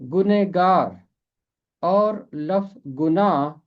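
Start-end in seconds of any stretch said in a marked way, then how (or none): 0.1-1.0 गुनहगार read as 'gune-gaar'; short-form reading. Shown as a demonstration. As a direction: say गुनहगार with the middle shortened to 'ne'. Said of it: "तो फिर इसे पढ़ा जाएगा".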